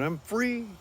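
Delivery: high, excited cry; still screaming. Retold or imitated. Freed man still crying out to passersby. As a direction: keep yelling it to the street. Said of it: "the man's still screaming".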